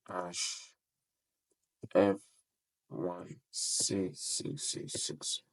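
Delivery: slow, soft mumble while typing the code; half-voiced murmur, spelling out characters; muttered to self. Real, not acted. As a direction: read the code under your breath.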